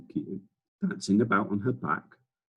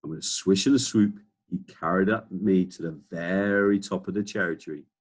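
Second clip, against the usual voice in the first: ordinary delivery, nothing marked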